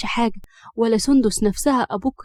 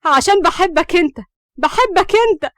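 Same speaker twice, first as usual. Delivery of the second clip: high, loud heated outburst; angry confession; 8 semitones higher, 6 dB louder than usual. A narrator's reading. Hams confesses love to Siraj, very worked up. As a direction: blurt out love in exasperation. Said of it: "اتعصبت همسة أكتر وقالت له".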